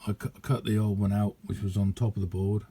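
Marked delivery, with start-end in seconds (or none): none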